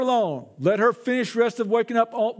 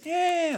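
short, high mock wail; imitated child's crying; 7 semitones higher than usual. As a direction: mimic a toddler bawling. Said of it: "Just crying".

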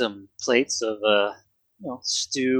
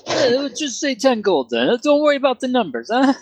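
very high Canadian barkeep; high put-on Canadian accent, taking an order; mock Canadian accent; 9 semitones higher than usual. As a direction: serve customers as a hammy Canadian innkeeper. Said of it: "And he had an accent".

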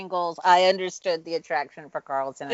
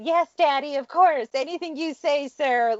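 high, meek, fawning compliance; mock submissive; 9 semitones higher than usual. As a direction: grovel sweetly in a higher voice. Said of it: "She may well just said".

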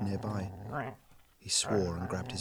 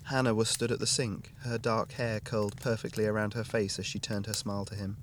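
usual speaking voice

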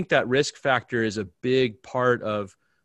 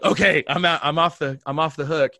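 loud, higher, quick let-off; eager relief; 4 semitones higher, 9 dB louder than usual. Retold or imitated. Pastor refused by the guest, loudly relieved. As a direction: jump at the escape, pitched up.

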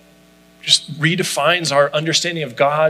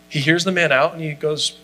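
usual speaking voice

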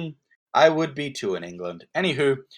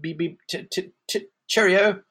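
mock British; 6 semitones higher than usual. Mock English stereotype.

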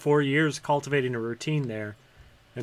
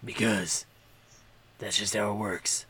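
lightly held low hero voice; a hint of a deep superhero; Batman voice; 4 semitones lower, 4 dB quieter than usual. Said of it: "Now I talk like Batman".

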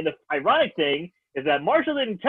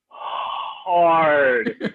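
"hard" held very long; drawn-out emphasis; 4 dB louder than usual. Real, not acted. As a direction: stretch out "hard" for comic weight. Stretches the word for emphasis.

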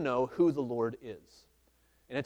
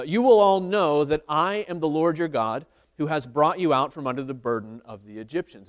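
louder, measured Bible reading; scripture reading; 7 dB louder than usual. Acted. Preacher reading Exodus aloud to the church, steady and louder. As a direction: read the verse out to the congregation.

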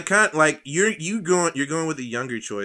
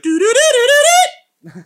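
very high, loud rising doo-doos; cartoon sound effect; 16 dB louder than usual. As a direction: squeal it like a cartoon jingle. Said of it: "his dick would be like".

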